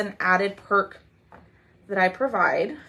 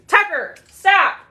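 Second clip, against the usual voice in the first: sharp mom voice, much higher and louder; 9 semitones higher, 6 dB louder, slower than usual. Real, not acted. Mom stopping her dog Tucker with a sharp mom voice. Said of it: "I had to get my mom voice out".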